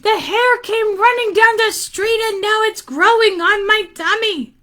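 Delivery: high-pitched lady complaint; distressed lady. Acted. Lady telling boy what happened, high and distressed. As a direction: keep it high and fretful throughout. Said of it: "said the lady".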